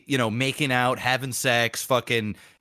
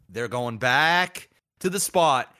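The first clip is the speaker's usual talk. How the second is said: usual speaking voice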